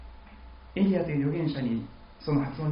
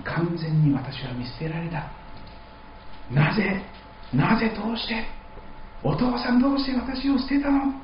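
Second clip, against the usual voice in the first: put-on abandoned child's cry; 7 semitones higher than usual. Jesus as a child abandoned by his father, crying out in rising despair. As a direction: beg your father why he left you. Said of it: "親に捨てられていく子供の叫び".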